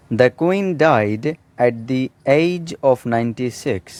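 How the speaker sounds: a deliberate dictation pace; slow example sentence. Shown as a demonstration. Teacher reading a model sentence slowly to students. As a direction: read slowly, every word clear. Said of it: "एज मतलब उम्र".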